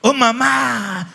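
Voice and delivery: put-on slow wail of a child for mum; a hint of a child wailing; child's cry. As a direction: draw it out like a scared child.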